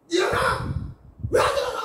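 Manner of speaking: high, loud imitated shout at the child; hammed-up furious wake-up yell; angry mom shout. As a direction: bark it like a fed-up mother at the bedroom door. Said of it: "하고 딱 소리를 치는 순간".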